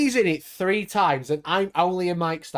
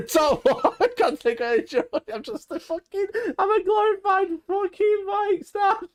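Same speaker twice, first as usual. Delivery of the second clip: laughing; 11 semitones higher than usual. Real, not acted. Laughing about being cut.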